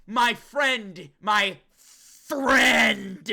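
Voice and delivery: hammed-up loud stress, friend drawn out; Yuki. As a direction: blow up the word friend in mock drama. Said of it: "he's like".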